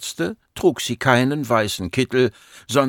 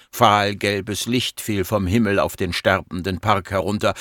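usual speaking voice

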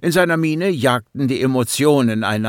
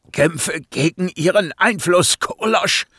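higher, forced out through pain; squeezed out with effort; strained; 7 semitones higher than usual. A narrator's reading. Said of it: "presste er hervor".

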